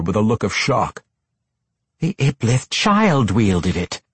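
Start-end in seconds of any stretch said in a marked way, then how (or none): 2.0-4.0 slow, higher-pitched scolding; a drawn-out reproach with mild force. A narrator's reading. Matron mother rebukes her weapons master, scolding. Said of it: "matron mother Quenthel scolded".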